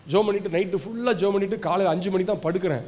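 usual speaking voice

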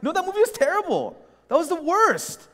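high-pitched indignant verdict; opinionated protest. Retold or imitated. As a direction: re-enact an exasperated objection. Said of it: "I'm very opinionated".

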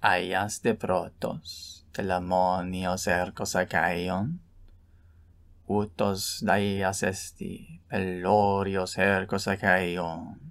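slow, measured epic chant in Greek; Greek verse recitation. Shown as a demonstration. Teacher reciting Homer's lines about Ajax, slow chant. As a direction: intone Homer line by line. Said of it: "Here are some examples".

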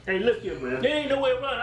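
ordinary talk

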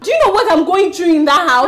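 high, exasperated demand; distressed outburst. Acted. Woman venting to her friend about home, distressed.

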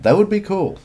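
light up on "would" with a sharp high jump; excited. Real, not acted. Excited about a manga idea.

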